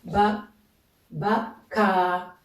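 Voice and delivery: "Ba, ba, ka" with pauses, last "ka" drawn out; syllable-by-syllable reading. Shown as a demonstration. Teacher repeating the example for learners, "ba, ka" split with "ka" held long. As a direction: say "ba" apart, then "ba, ka" with a long final a. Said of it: "on va lire ici".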